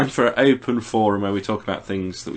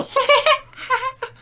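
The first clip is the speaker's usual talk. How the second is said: put-on much higher falsetto baby laugh; imitating the baby character giggling; high baby giggle; 3 dB louder than usual. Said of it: "And then he'd go".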